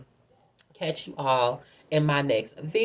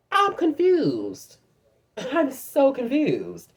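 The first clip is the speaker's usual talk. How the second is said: much higher and slow, drawn-out fake bewilderment; mock confusion; 9 semitones higher, much slower than usual. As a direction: play dumb, stretching every word up high. Sarcastic disbelief at shy man.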